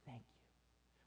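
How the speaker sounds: barely audible, low thanks; so quiet. Acted. Believer acknowledging an answered prayer, extremely quiet. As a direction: murmur it almost to nothing. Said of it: "we're often so quiet".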